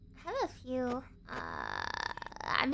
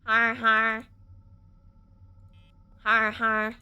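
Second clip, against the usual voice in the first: loud, lower, flat "Har, har" spelled out like words; sarcastic fake laugh; 4 semitones lower, 10 dB louder, slower than usual. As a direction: bellow a mocking pirate-style har har.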